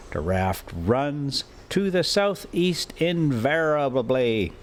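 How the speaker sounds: usual speaking voice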